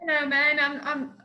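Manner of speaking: ordinary delivery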